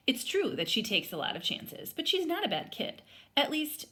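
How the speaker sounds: put-on lively read-aloud voice for children; story read aloud. A narrator's reading. Teacher reading a picture book aloud to students, lively storytelling. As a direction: bring the story to life for the class.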